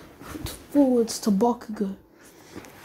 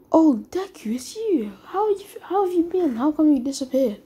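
higher-pitched, lively greeting; light, higher acted surprise at a reunion; pleased surprise; 5 semitones higher than usual. Bakugo greets Deku who had disappeared, pleasantly surprised.

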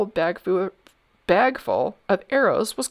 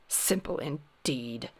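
slow, low, soft haughty mumble; 4 semitones lower, 8 dB quieter, slower than usual. A narrator's reading. Sir Snips answering the king's challenge, haughty mumble. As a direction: mumble it slowly with disdain. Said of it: "mumbled Sir Snips haughtily".

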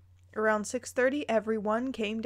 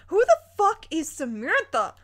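exasperated outburst; 6 semitones higher, 5 dB louder than usual. Real, not acted. Exasperated reaction to story.

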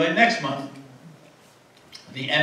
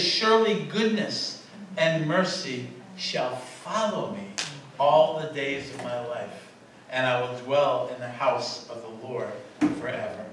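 scripture recital; 2 semitones lower, 4 dB quieter than usual. Acted. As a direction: read it as a reverent psalm. Reciting Psalm 23.

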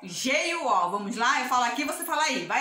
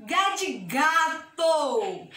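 slowly, stretching "gato" by syllable; slow syllable demo; much slower than usual. Shown as a demonstration. Teacher giving children a G word, slowly sounded out.

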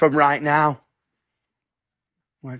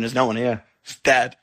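ordinary talk

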